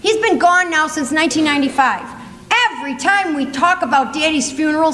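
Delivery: ordinary delivery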